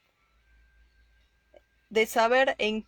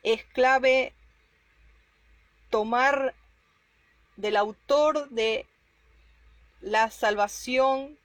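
fervent preaching. Acted. Preacher urges the brothers.

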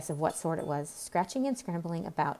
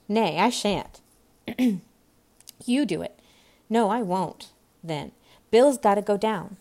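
raised, brisk crew voices; squabbling workmen; 3 semitones higher, 6 dB louder than usual. Acted. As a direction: argue over who does the job. Workers argue over who goes down.

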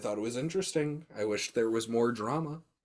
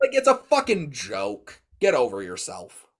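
mock annoyed; 4 semitones higher, 8 dB louder than usual. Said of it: "And I was like".

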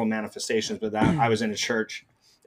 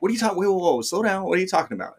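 higher-pitched, baffled reply; retold bewilderment; 8 semitones higher, faster than usual. Man acting out his reply to his frantic brother, bewildered. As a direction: cut in to rein in a frantic caller.

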